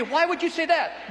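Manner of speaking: high, puzzled challenge; why on earth would you say that; incredulous question. Retold or imitated. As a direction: ask it as a baffled listener. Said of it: "Now, you say".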